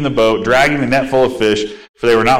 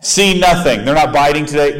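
sarcastic, higher, grudging; grumbling fisherman mocking the advice; spiteful sarcasm; 3 semitones higher than usual. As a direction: toss it off out of spite. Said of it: "just out of spite".

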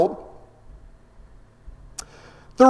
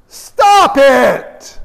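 drawn-out, emphatic scolding; sharp rebuke; 3 semitones higher, 5 dB louder than usual. Acted. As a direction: cut off the glib talk firmly. Rebukes the glib believer.

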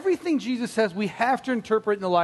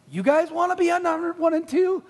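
high-pitched mock indignation; indignant; 8 semitones higher than usual. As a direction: protest it high, acting ticked off. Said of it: "they're indignant".

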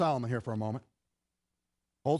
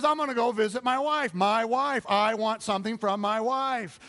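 put-on eager lover boy; 6 semitones higher, 4 dB louder than usual. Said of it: "He says".

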